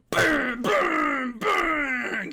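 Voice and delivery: three loud, high, drawn-out bangs; yelled gunshot noises; imitated gunshots.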